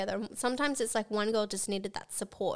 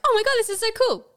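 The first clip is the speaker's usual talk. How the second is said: put-on childlike excitement; 14 semitones higher, 10 dB louder than usual.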